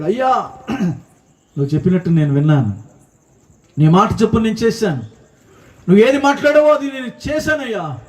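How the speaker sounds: a healed man pouring out thanks; rising joyful gratitude; grateful Naaman. Acted.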